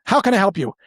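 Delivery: salesperson greeting. Retold or imitated. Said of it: "salesperson typically always will say".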